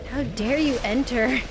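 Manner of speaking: ordinary talk, nothing marked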